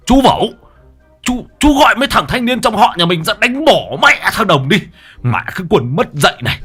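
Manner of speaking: enraged bald man shouting to his brother; furious cursing. A narrator's reading. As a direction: hurl curses after the fleeing men. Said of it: "tiếng chửi bới".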